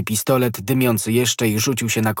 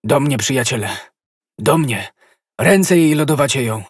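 louder, rising panic calling to friends; urgent call for help; 4 semitones higher, 5 dB louder than usual. A narrator's reading. D'Artagnan calls his friends as she faints, urgent. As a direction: summon your friends, frightened. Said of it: "zawołał D'Artagnan".

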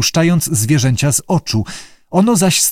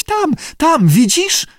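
very high panicked squeal; squealing in fright; slower than usual. A narrator's reading. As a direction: shriek and point in terror. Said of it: "zaczęła piszczeć". (piskliwy, przerażony krzyk)

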